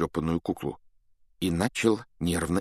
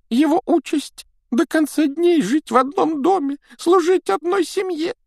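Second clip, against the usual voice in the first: very high, squeaky elf voice; eager little house-elf, squeaking; 6 dB louder than usual. A narrator's reading.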